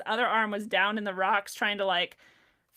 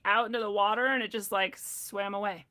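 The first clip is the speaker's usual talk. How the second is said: ordinary delivery, nothing marked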